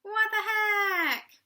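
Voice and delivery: high, slow laughing cry of disbelief. Retold or imitated. Fan reacting to the backstage heckle, laughing high. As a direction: laugh it out in shock. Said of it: "And I was just like".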